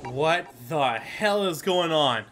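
normal talking voice

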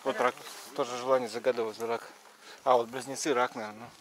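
ordinary talk, nothing marked

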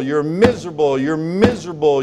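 ordinary talk

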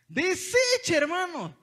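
high, loud outraged repeat; rising dismay. Real, not acted. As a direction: hit it high in disbelief. Dismay at the wrecked box.

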